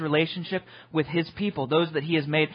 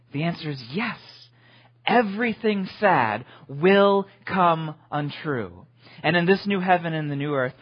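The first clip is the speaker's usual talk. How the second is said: ordinary delivery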